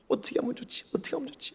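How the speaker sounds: very soft, voiceless fretting; frightened whisper. Retold or imitated. Disciples too scared to think, whispering in fear. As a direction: whisper in mock panic. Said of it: "너무 무서워가지고 막".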